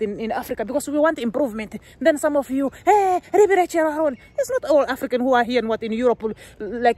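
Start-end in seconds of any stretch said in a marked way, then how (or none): 2.8-4.2 much higher, slow mocking call; taunting commenter. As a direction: drag the name out in a teasing voice. Mimicking critics' taunt.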